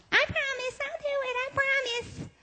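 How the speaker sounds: put-on very high whiny kid voice; child pleading. Child promising mother to stay in room, high whiny plea. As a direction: beg like a little kid promising. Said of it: "Your mother says... you break a promise".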